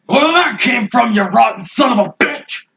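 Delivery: yelled slow and high, full rage; furious shout. Acted. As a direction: roar the taunt at the enemy. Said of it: "That's it".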